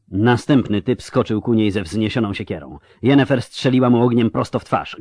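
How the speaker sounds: ordinary delivery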